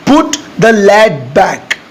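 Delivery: word by word, very slow; slow dictation pace. Shown as a demonstration. Teacher dictating the English sentence to students, very slow. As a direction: spell it out slowly for learners. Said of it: "ढक्कन लगाने को आप simply बोलिएगा".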